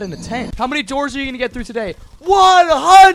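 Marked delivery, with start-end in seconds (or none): 2.2-3.2 high excited yell of one hundred; shouted answer. Acted. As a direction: shout it out at full volume. Game character shouting door count.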